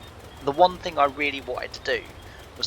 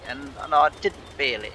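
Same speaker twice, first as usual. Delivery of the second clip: ordinary delivery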